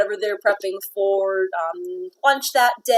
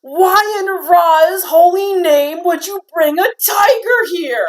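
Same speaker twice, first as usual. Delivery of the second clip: angry orc shout; 7 semitones higher, 4 dB louder than usual. Acted. Orc cook demands about tiger.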